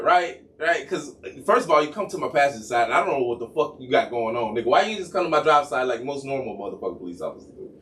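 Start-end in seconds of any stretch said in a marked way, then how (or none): none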